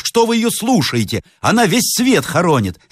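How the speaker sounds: high-pitched dismissive protest; exasperated servant. Acted. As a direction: brush her words off to the master, pitched high. Servant interrupts, dismissing madwoman. (слуга досадует, высоким голосом)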